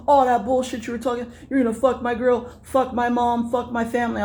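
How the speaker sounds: high, fast, ranting imitation; imitating him going off. Comic imitating a rival ranting at him, high and fast. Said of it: "He's, like, going off".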